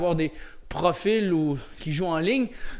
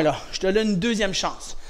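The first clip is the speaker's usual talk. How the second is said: normal talking voice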